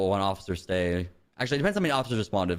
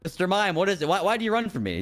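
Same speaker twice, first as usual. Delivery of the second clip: bewildered cop; 8 semitones higher than usual. Acted. Cop confronts the kneeling mime.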